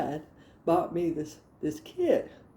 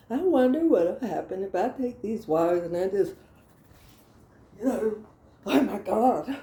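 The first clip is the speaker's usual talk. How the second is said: usual speaking voice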